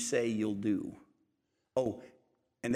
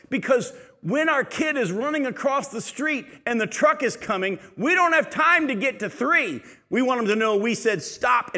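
louder, higher preacher's drive; full-force preaching; 8 semitones higher, 6 dB louder than usual. Acted. Preacher urges parents to mean it the first time, fervent preaching. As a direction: press the point home from the pulpit.

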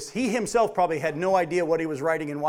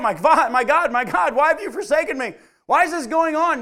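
loud, higher anguished crying out; 8 semitones higher, 6 dB louder than usual. Retold or imitated. Preacher voicing Jesus crying out on the cross, loud anguished cry. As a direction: cry it out to heaven, louder and higher. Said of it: "Jesus cried out".

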